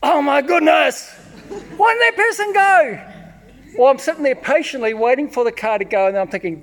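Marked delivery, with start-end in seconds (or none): 0.0-3.1 high, loud, hammed-up frustration; exasperated driver. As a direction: vent at the other car. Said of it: "I'm going".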